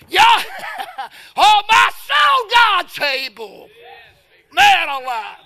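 high, loud, slow cries of joy; ecstatic shouted praise. Real, not acted. Preacher crying out hallelujah to the congregation. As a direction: let it ring out in triumph.

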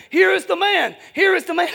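loud, high retold yell; imitating a scream. Preacher mimics Brother presenting him to the mob, imitated screaming. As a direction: holler it over a crowd, mimicking the shouter.

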